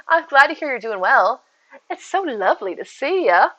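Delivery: sweet, put-on Southern hostess; rusty Southern belle impression; Southern belle accent. Shown as a demonstration. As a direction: greet with an exaggerated Southern lilt. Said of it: "it's gotten really weird".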